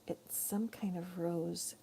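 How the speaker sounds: breathy whisper, slow; hushed, unsure whisper. Real, not acted. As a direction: murmur it to yourself, slowly. Musing over a flower's name.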